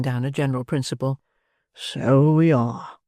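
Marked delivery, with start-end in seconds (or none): 1.7-3.0 slow beadle. Acted. Beadle agreeing.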